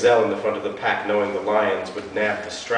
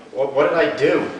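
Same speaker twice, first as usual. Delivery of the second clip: panicked question; 7 semitones higher, 8 dB louder than usual. Acted. Suspect confronted, stammering.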